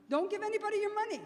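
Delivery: high, loud, rapid-fire Queens accent. Shown as a demonstration. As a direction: belt it out fast in Queens dialect. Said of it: "say it in my best Queens accent".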